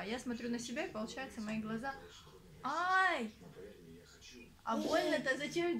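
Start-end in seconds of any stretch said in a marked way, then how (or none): 2.6-3.3 sharp cry of pain. Real, not acted. Baby hurts her while she films.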